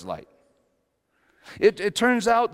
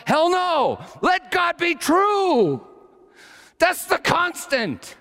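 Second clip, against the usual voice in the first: a preacher carried away, really shouting; worked-up shout; 12 semitones higher, slower than usual. Real, not acted. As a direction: preach it at the top of your voice. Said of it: "Sorry, I get worked up".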